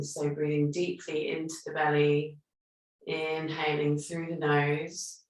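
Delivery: calm, unhurried meditation guidance; guided breathing meditation. Acted. Yoga teacher guiding her online class through breathing, calm and soothing.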